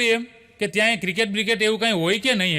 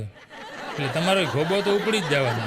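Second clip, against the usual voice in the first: laughing through it; 5 semitones lower, slower than usual. Real, not acted. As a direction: let the laugh spill into the words. Teller laughs through the punchline.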